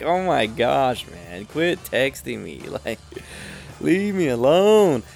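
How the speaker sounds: slow, drawn-out retold plea; exasperated. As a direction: drag the words out, fed up. Retold exasperation at unwanted texts.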